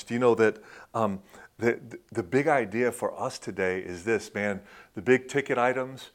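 normal talking voice

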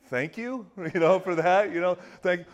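high, mock-grateful thank you through a laugh; sarcastic thanks. Nervous flyer mockingly thanks a tactless buddy with a laugh. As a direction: thank them with a forced laughing smile. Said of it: "And you're like".

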